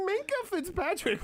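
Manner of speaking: high, prim elegant pronunciation; elegant housewife voice. Retold or imitated. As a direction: say it like a fancy housewife. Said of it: "trying to prove ... speak elegantly".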